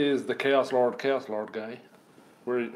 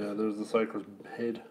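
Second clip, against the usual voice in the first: soft admiring whisper; 2 semitones lower, 6 dB quieter than usual. Real, not acted. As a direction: murmur it in awe. Hushed, admiring the sprue.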